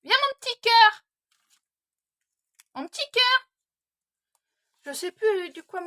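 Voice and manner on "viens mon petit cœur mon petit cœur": high, loud, sweet call to the dog; loud coaxing call. Real, not acted. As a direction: coax the dog back, calling out tenderly. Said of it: "Je suis désolée". (appel fort et tendre au chien)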